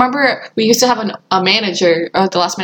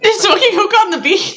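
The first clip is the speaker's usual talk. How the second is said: high-pitched, amazed question; incredulous; 8 semitones higher than usual. Real, not acted. Friend hears about hookah on the beach, incredulous. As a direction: break in, astonished.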